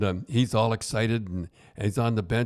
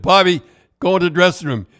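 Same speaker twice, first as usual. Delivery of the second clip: louder, insistent command to the player; firm order; 6 semitones higher, 9 dB louder than usual. Retold or imitated. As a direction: send him off firmly, louder. Coach ordering player, retold.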